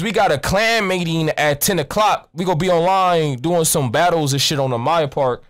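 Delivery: ordinary delivery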